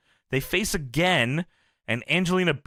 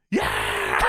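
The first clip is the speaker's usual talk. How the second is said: sudden low shouted cheer; mock cheer. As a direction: yell it like a crowd pop. Cheering shout in retold scene.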